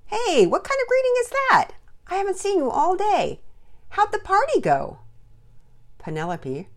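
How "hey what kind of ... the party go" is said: loud, high, booming-with-energy dad; hearty fatherly greeting. A narrator's reading. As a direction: protest playfully at a weak hello. Father heartily greets glum daughter.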